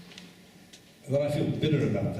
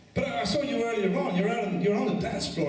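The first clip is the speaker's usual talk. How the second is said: higher, lightly voiced woman; a hint of the nosy woman, pitched up; mock woman's voice; 10 semitones higher than usual. Woman speaks, same voice.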